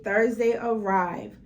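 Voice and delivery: ordinary delivery, nothing marked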